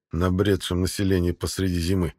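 plain reading voice

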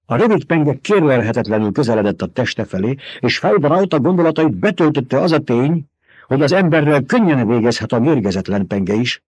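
plain reading voice

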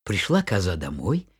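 plain reading voice

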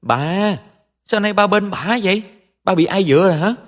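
very high-pitched, indignant wail; petulant yelling. A narrator's reading. Spoilt daughter protests to her father, petulant yelling. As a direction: yell back like a spoilt daughter. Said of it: "dậm chân lại hét lên".

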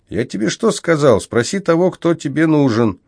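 impatient, snapping, pitched high; irritated. A narrator's reading. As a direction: scold him with annoyance. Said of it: "раздражённо сказал Микс".

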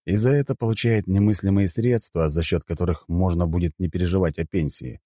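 usual speaking voice

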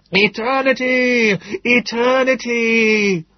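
high, loud, slow drawn-out cry; bawling. Acted. Salvation Army man on a step bawling at the crowd, slow and loud. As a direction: bawl it out to the street like a preacher. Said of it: "He stood on a step and bawled".

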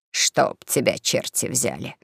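low, slow, understated irritation; hot-tempered. Acted. Bald swindler cursing his sighing companion, low understated anger. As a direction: curse slowly with restrained temper. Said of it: "крикнул Плешивый запальчиво".

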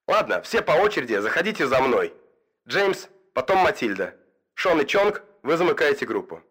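crackling headset commander voice; radio voice. Acted. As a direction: give orders over the radio. Потрескивает голос командира в наушниках. (голос по рации)

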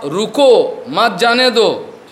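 रुको set apart, then मत जाने दो slowly; intended phrasing. Shown as a demonstration. Teacher showing what the master meant, slow, pause after रुको. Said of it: "उसको बोलने का उद्देश्य था".

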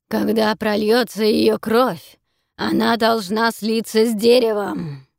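loud, slow menacing chant; booming creature voice. Acted. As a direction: proclaim it like a monster. Creature proclaims the blood ritual.

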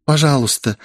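breathed-out, halting request; exhaled plea. A narrator's reading. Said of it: "выдохнула Оливия".

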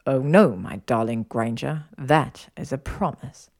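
low, measured male voice; affirming lover. Acted. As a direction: promise it slowly and suavely. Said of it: "he affirmed".

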